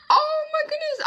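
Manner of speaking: high, tearful gush over a kitten; emotional squeal. Real, not acted. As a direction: melt with delight, near tears. Showing her new cat.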